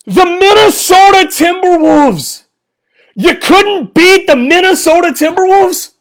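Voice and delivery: incredulous shouting at the team; ranting fan yelling at his team; angry rant. Real, not acted. Angry post-game rant.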